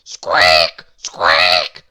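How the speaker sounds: very loud, harsh mouse squeaks; noisy mice roaring squeaks; roared mouse squeak. A narrator's reading. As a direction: roar like the noisiest mouse in the world. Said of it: "they'd roar at each other".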